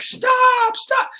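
high, shrill-free mock yell; mock yell stop. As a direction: shout at kids to stop. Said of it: "you don't go like".

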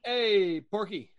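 loud high greeting call. Real, not acted. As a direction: call out a cheerful hello. Greeting a newly joined guest.

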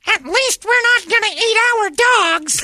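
very high, slow falsetto yell; mock shouting woman. Woman shouting after departing man, mock falsetto shout. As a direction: call it from afar in a squealing falsetto. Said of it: "Megan shouted in a tender voice".

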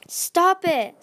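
high, loud, upset command; distressed outburst. A narrator's reading. Tsunami refuses to believe it of her mother, crying out. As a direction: cry out to shut them up. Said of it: "Tsunami clutched her head".

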